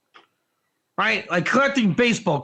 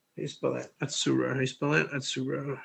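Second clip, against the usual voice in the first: mumbled aside; 9 semitones lower, 10 dB quieter, faster than usual. Real, not acted. Spelling word while checking.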